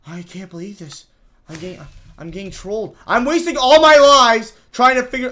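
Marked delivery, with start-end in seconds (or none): 3.1-5.3 high, loud complaint; frustrated shout. Real, not acted. Gamer wasting lives on a puzzle, frustrated shout. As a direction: burst out in frustration.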